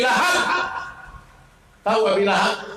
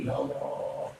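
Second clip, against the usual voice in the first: sad sigh; 4 semitones lower, 10 dB quieter, slower than usual. Acted. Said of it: "Abu Bakar sudah sedih".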